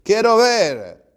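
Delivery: very loud, high, long-held cry; shouted with faith. Retold or imitated. Blind man answering Jesus with faith, shouting. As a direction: shout the wish out with full conviction. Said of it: "le dijo con fe".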